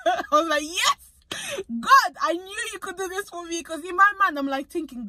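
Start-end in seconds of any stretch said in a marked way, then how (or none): 0.6-3.6 high-pitched burst of joy on Yes, then easing down; gassed excited cheer. Retold or imitated. Nurse trainee celebrating a successful blood draw, excited squeal. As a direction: cheer inwardly after getting the blood first go. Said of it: "I was gassed".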